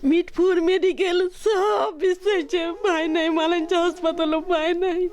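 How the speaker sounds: real grieving father sobbing through words; choked-up sobbing, voice breaking; snotty crying. Real, not acted. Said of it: "আবেগ আপ্লুত হয়ে পড়েন".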